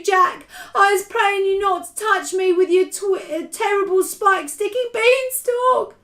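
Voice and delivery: high, wailing, pleading terror; screamed. A narrator's reading. Giant begging Jack for mercy, screamed in panic. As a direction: wail and beg for your life. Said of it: "screamed the flesh lump eater".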